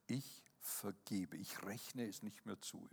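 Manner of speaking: quiet, slow solemn forgiving; softly spoken vow of forgiveness; slow, soft declaration. Shown as a demonstration. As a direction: lay each word down gently and deliberately. Demonstrates the forgiving words.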